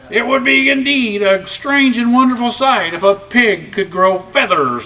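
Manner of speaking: lightly voiced crowing cock. A narrator's reading. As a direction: strut it like a proud rooster. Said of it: "the cock crowed".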